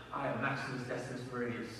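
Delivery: low, hushed gladiator declaration; Maximus. Acted. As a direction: quote the gladiator quietly and quickly, low-pitched. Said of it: "like, super chilling speech".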